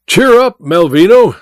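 high, loud cheery call; hearty shout to the man inside; calling through the door. A narrator's reading. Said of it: "said Welton through the door".

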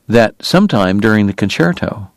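ordinary delivery, nothing marked

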